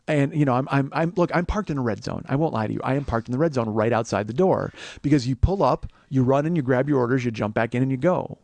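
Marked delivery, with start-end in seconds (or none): none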